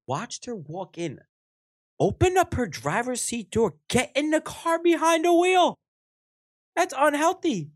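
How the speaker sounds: rising alarm. Real, not acted. Alarm over old lady driving.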